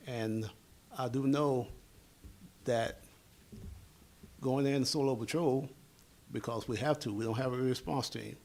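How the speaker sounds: ordinary delivery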